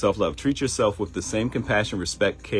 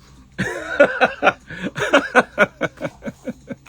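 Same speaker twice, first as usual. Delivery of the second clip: big laugh; 8 dB louder than usual. Real, not acted. Laughs at own joke.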